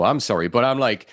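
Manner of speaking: usual speaking voice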